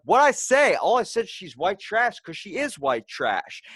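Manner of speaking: high, loud retold outburst easing off toward the end; indignant protest. Student protests to teacher about punishment, indignant protest. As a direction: act out a kid loudly defending himself. Kid protests being sent to principal.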